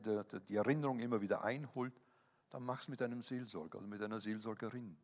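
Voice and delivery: normal talking voice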